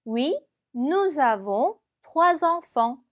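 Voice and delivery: slow, careful French model sentence; slow teacher's French read-aloud. Shown as a demonstration. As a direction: read it slowly like a language tape. French dialogue line, slow.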